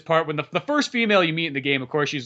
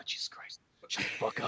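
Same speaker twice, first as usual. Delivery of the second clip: whispered aside of disbelief; exasperated whisper; 16 dB quieter than usual. Acted. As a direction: whisper it, fed up with the squealing kid. Exasperated reaction to kid voice.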